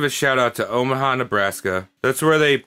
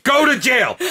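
mock verdict; 11 semitones higher than usual. Mock sentencing the poet.

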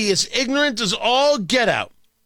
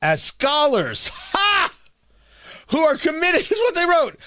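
mocking laugh; 8 semitones higher than usual. Real, not acted. Mocking the letter while laughing.

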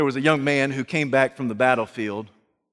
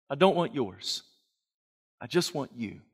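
gentle Jesus; 8 dB quieter than usual. Acted. Jesus gently telling Martha he wants her, not her service. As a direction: speak it softly as to a friend.

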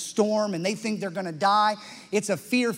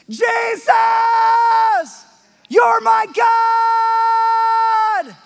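high, loud triumphant shout; cry of faith; 13 semitones higher, 10 dB louder, much slower than usual. Shown as a demonstration. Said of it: "what a cry of faith would sound like".

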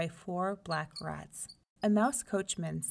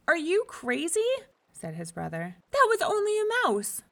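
loud, higher, mocking disbelief; scoffing brother; 11 semitones higher, 7 dB louder than usual. The brother mocks Meeko over the mouse, loud and scornful. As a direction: sneer at his foolish brother.

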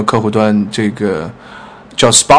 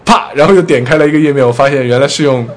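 ordinary talk

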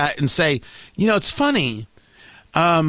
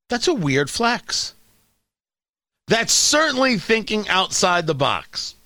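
ordinary talk, nothing marked

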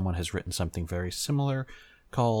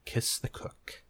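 whispered; 6 dB quieter, slower than usual. Acted. Sora teasing after the kiss.

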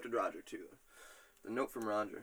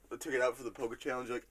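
ordinary talk

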